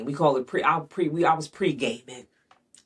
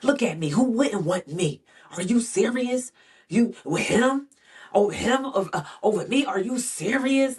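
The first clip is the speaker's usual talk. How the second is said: mocking grandiose boyfriend; 10 semitones higher, 3 dB louder than usual. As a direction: ham up his disbelief that she'd pick another man. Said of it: "he would be like".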